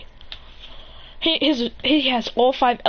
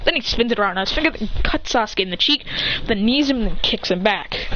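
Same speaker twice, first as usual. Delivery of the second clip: usual speaking voice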